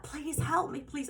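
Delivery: high-pitched desperate cry for help, reenacted; tearful plea. Girl begging at a stranger's door, high desperate pleading. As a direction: retell a crying plea with the pitch jumping up. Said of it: "I'm really, really crying".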